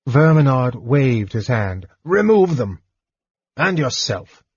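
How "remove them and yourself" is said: sharp, higher commanding bark; harsh dismissal. Acted. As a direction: snap the order at the servants. Lord dismissing his servants harshly.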